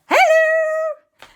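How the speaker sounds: put-on very high, loud kid shout; high child greeting. Boy greeting his mother, very high and loud. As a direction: squeal hello like a little boy. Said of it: "And Aunt Lisa".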